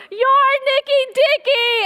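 put-on high, loud, slowed child's yell, bursting in; a little boy flinging the door open and shouting gleefully; excited kid shout. Youngest son bursting into the bedroom, high gleeful shout. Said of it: "my littlest goes".

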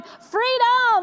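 high, bright shout of joy, mimicking her daughter; gleeful cry of freedom. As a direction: echo the daughter's thrilled cheer as she's dropped off. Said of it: "and she's, like, going".

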